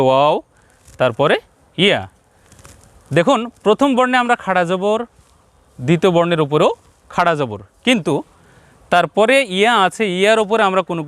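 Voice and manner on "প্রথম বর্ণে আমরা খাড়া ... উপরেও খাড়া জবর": ordinary talk, nothing marked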